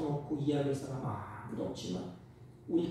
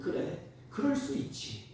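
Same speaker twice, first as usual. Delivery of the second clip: softer, hushed reassurance; soft empathy; 7 semitones higher, 6 dB quieter than usual. Retold or imitated. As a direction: say it like a parent who understands. God empathizing softly.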